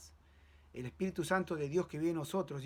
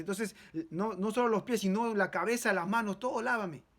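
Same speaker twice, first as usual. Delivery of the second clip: higher, louder eager begging; eager plea; 4 semitones higher, 6 dB louder than usual. Acted. Peter begs Jesus to wash him wholly, eager and loud. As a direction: throw himself into it, wash all of me.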